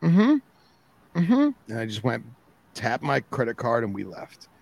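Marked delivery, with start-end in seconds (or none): 0.1-1.5 high, tight mm-hmms holding back anger; forced polite mm-hmm. Retold or imitated. To the rude cashier, forced polite high mm-hmms. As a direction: bite your tongue and hum agreement. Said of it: "I bit my tongue and I said".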